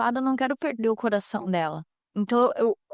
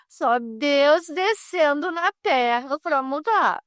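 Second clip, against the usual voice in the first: slow, weighty quoted saying; quoting the pastor; 4 semitones higher, much slower than usual. Retold or imitated. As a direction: lay it down like a preacher's saying, very slowly. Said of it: "frase que o pastor Luciano fala".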